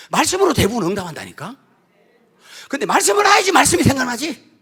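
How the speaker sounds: loud, high preaching in full force. Acted. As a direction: preach it out with fire.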